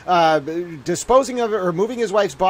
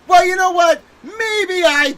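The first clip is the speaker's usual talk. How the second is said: indignant shout; mock defiant retort; 10 semitones higher than usual. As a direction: fire it back at the bunny. Retorts to the bunny.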